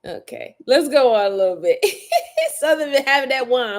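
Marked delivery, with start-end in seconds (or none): 1.9-2.5 a high giggling laugh; high burst of laughter. Real, not acted. Laughing at viewer.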